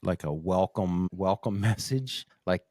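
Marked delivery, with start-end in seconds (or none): none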